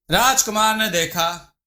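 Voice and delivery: usual speaking voice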